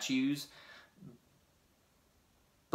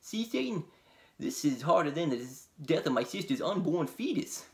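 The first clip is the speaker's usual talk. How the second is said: goofy dopey demon, pitched up; 5 semitones higher than usual. Acted. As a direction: play a goofy dim-witted Satan. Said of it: "that would be Satan".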